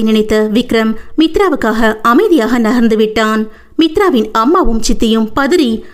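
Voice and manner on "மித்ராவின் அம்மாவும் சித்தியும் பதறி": ordinary delivery, nothing marked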